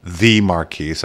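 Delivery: "the" drawn out as a grand "thee"; long, weighty "the" before the name Marquise; stress on "the". Shown as a demonstration. Host introducing his guest by title, stress on "the". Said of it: "when the word "the" came into play".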